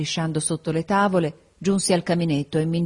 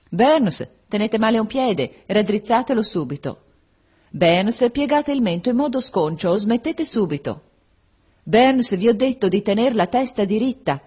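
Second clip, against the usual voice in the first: stern scolding teacher; 5 semitones higher, 3 dB louder than usual. A narrator's reading. Teacher scolding pupil in class.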